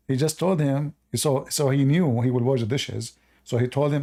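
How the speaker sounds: ordinary talk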